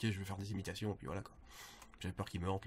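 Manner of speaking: ordinary delivery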